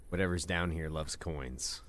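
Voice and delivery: ordinary talk, nothing marked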